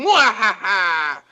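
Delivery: slow hammy villain laugh; drawn-out mock evil laugh; evil cackle. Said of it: "He's like".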